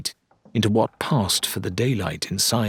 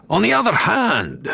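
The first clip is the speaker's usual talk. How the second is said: high, grandiose salesman patter; showman dealer; 12 semitones higher than usual. Acted. Dealer Wilbur pitching a blunderbuss, grandiose showman voice. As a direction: sell it like a carnival barker.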